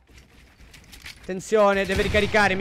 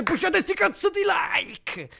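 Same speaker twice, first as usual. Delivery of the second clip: excited shout; 8 semitones higher, slower than usual. Real, not acted. Hype call to viewers.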